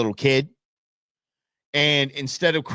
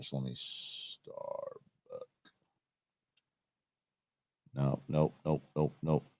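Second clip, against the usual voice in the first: muttering to self; 11 semitones lower, 13 dB quieter than usual. Real, not acted. Muttering while searching the name.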